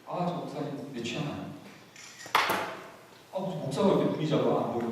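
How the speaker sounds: low, soft mock grumble; muttered griping. Churchgoer sizing up the pastor, imitated quiet grumbling. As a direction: complain under your breath, half to yourself.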